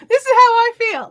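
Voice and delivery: high, loud exclamation of suffering; complaining loudly about the sticky heat; loud heat groan. Real, not acted. Vlogger to chat, moaning about humidity, loud and high.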